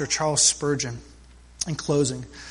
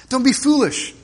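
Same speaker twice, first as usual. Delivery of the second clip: higher, louder exclaim; mild exclamation; 7 semitones higher, 4 dB louder than usual. A narrator's reading. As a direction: brush it off with a friendly exclamation. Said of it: "exclaimed the neighbor".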